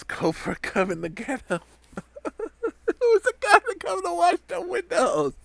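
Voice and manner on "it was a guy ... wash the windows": much higher shouted punchline, laughing; laughing yell. Retold or imitated. Retelling joke in squeaky voice.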